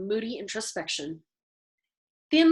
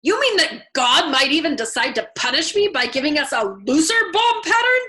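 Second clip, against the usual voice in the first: loud and rising higher, panicked; alarmed; 5 semitones higher, 8 dB louder than usual. A narrator's reading. Colonel fearing God will punish him, panicky rising outcry. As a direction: voice climbing in horror at the thought.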